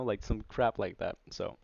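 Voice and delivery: ordinary delivery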